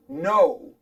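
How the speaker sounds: "No" said loud and alone, set off by pauses; stress on No. Real, not acted. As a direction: punch "No" hard as a single emphatic word. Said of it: "I'm emphasizing the word no".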